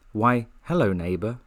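normal talking voice

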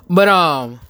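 ordinary delivery

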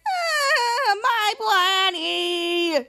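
high, drawn-out mock wail; hammed-up crying, stretched out long; retold crying. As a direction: wail it slowly like a crybaby. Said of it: "Matt is crying".

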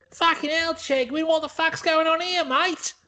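high, outraged Aussie; Australian accent. Acted. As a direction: shout it up high like an angry Aussie bloke. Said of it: "It's a great accent".

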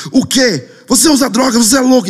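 high-pitched indignant outburst; very angry. Retold or imitated. Angry helper accusing storyteller of drugs, outraged. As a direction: mimic an outraged man hurling accusations. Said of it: "começou a ficar muito bravo".

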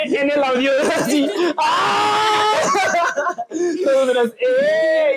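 high whooping cheers; excited cheering. Real, not acted. Hosts welcoming guest with high whoops.

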